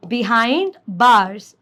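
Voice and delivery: Behind bars, very slow with the b sounds hit; stressing first sounds. Shown as a demonstration. Teacher showing alliteration, slow stress on the b in Behind bars. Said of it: "ब, ब, first, first".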